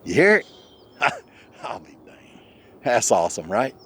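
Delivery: usual speaking voice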